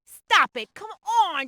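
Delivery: ordinary talk, nothing marked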